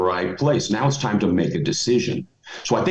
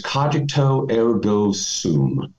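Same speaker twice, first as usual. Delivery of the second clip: slow Latin phrase; much slower than usual. Shown as a demonstration. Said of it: "I love the Latin phrase".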